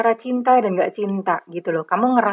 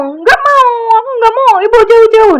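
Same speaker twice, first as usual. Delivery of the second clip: much higher, louder whiny refusal; tantrum child refusing; 12 semitones higher, 10 dB louder than usual. Retold or imitated. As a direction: push mum away like a screaming toddler. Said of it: "giliran ibunya mendekat, dia nggak mau". (anak tantrum menolak ibu, merengek tinggi dan keras)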